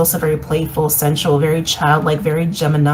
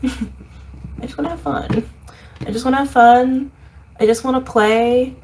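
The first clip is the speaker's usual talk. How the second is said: put-on higher, playful little-kid voice; inner child; 5 semitones higher than usual. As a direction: speak as the longing inner child wanting to play. Reader voices inner child's wish.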